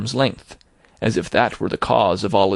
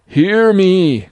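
high, loud ghostly cry for attention; ghost's cry; 9 semitones higher, 6 dB louder than usual. A narrator's reading. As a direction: call out urgently as a ghost. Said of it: "cried the ghost".

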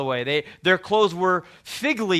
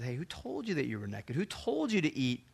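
soft questioning; 3 semitones lower, 11 dB quieter than usual. Acted. God questions Adam in the garden.

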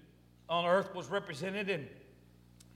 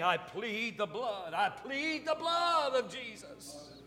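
slow, drawn-out plea rising high; stretched prayer cry climbing to the end; mother's pleading prayer; 4 semitones higher, slower than usual. Retold or imitated. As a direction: imitate a mother praying, swelling in pitch. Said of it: "I could hear her in my mind praying".

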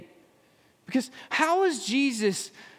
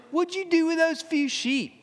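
mocking, high-pitched sneer on "those few sheep"; sarcastic jab; 7 semitones higher than usual. Eliab mocking David's lowly job, sarcastic sneer. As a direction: belittle him with a sneering lilt. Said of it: "You hear that?".

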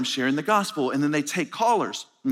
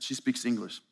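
ordinary delivery

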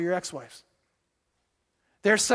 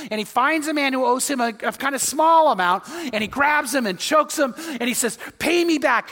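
louder, higher, building indignation; rising, indignant retelling; rising outrage; 5 semitones higher, 4 dB louder than usual. Retold or imitated. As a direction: tell it with mounting outrage. Retelling the cruel servant.

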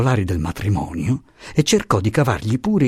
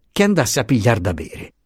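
ordinary delivery

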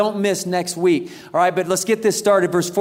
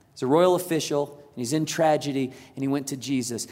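quiet recap; 6 semitones lower, 6 dB quieter than usual. Shown as a demonstration. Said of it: "three points repeated".

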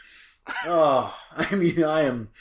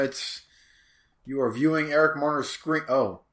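ordinary talk

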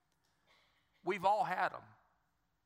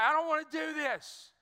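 put-on higher, far-from-normal whiny kid protest; protesting little boy; 6 semitones higher than usual. Dad imitating his son facing stitches, pleading protest. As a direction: imitate a child balking, pitched up.

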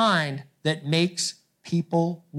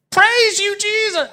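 much higher and louder joyful cry; healed leper shouting praise; 14 semitones higher, 7 dB louder than usual. Retold or imitated. Healed leper crying out to Jesus, a high loud shout of praise. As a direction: let it ring out like a voice just set free. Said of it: "I would love to hear what he sounded like".

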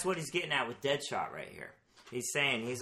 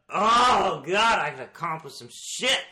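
loud drawn-out "Oh", then easing off; a touch of mock revelation; acted realization; 4 semitones higher, 8 dB louder than usual. Acts out Deadshot's sudden realization.

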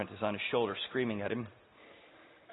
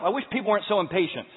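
mock complaint; 6 semitones higher, 6 dB louder, faster than usual. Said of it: "You'll say to yourself".

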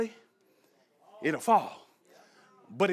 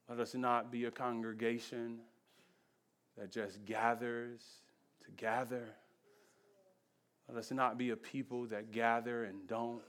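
quiet closing prayer; 5 semitones lower, 3 dB quieter than usual. Acted. Pastor praying over the congregation, softly and low. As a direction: pray gently, fading to a hush.

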